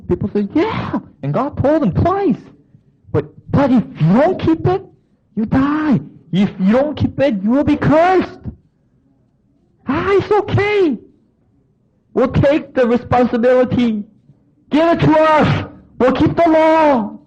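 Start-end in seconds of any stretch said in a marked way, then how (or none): 0.5-1.0 much higher eager yes; eager people. Retold or imitated. People eagerly answering God, much higher. 1.2-8.4 much higher urgent warning; raised warning. Retold or imitated. Preacher voicing God warning the people, high and urgent. 9.9-17.2 careless people. Retold or imitated. People dismissing God's warning, high and cocky.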